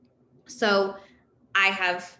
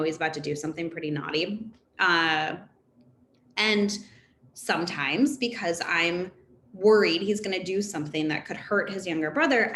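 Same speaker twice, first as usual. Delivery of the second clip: ordinary talk, nothing marked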